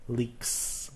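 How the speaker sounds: LEAKS with a long, soft hissed S; unvoiced S ending. Shown as a demonstration. Teacher repeating the example for learners, hissed S ending. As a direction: let the final s of "Leaks" hiss, quietly. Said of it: "you say the S sound".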